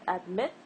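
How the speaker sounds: adMIT, rising on MIT; stress on second syllable. Shown as a demonstration. Teacher quizzes learners on stress, adMIT stressed on the second part. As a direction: hit the MIT of admit as a question. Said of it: "So it's admit, not admit".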